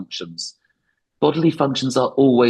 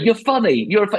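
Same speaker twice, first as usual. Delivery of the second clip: Goodfellas gangster; 9 semitones higher, faster than usual. Retold or imitated. Comic mimicking the Goodfellas gangster, mock menacing. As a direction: needle him like the mobster in Goodfellas, pitched up. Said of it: "the thing in Goodfellas".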